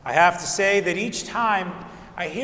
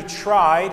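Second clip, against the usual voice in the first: "tried" leaned on and louder; "tried" said louder and stressed, as if to say it failed; 6 dB louder than usual. Shown as a demonstration. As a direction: punch the word "tried" with a wry weight. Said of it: "I emphasize the word tried".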